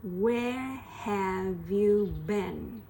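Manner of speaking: very slow "Where have you been"; slow drill. Shown as a demonstration. Teacher drilling the question slowly. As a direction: stretch each word.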